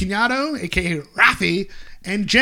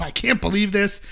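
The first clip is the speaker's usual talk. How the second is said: ordinary delivery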